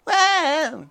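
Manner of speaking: high, loud whiny yelp; sudden high kid's cry of protest; mock kid shriek. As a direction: squeal like a poked little brother. Poked kid cries out.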